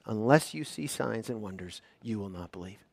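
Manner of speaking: quiet Jesus quote. Acted. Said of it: "the response of Jesus is".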